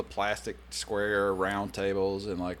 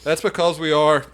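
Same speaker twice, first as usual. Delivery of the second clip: higher and louder, laughing through it; laughing protest; 9 semitones higher, 15 dB louder than usual. Retold or imitated. Sailor answering the chief who said loosen up, laughing protest. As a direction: protest while cracking up. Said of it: "And I go".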